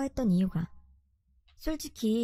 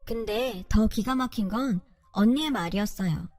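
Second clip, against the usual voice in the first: ordinary delivery, nothing marked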